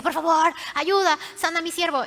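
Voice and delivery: desperate plea. Retold or imitated. Centurion begging Jesus for his servant, loud urgent plea.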